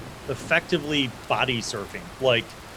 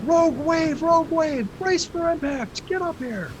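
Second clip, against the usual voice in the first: high, urgent yell from the deck; frantic alarm call; men yelling. Acted. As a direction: shout down the warning. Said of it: "two men yelling from up there".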